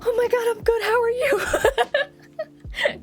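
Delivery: high, laughing, flustered reply. Retold or imitated. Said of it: "I am also very flustered".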